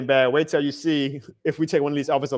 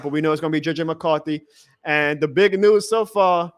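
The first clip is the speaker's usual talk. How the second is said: ordinary talk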